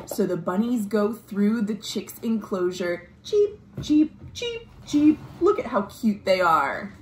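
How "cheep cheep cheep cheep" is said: high chirping cheep cheep; high, light chick chirps. Acted. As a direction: peep like little chicks. Imitating chicks in story.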